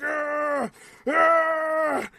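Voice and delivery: high mock shouts; imitated yelling. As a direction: yell out while choking the opponent. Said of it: "he was like".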